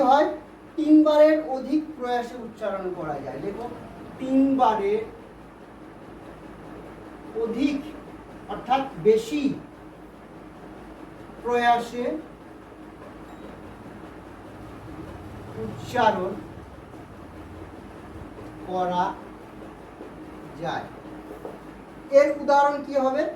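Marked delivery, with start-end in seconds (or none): 4.2-7.8 word by word with long pauses; slow dictation. Shown as a demonstration. Teacher dictates definition word by word. 11.4-20.9 word by word, long silences between words; slow dictation. Shown as a demonstration. Teacher dictates definition word by word.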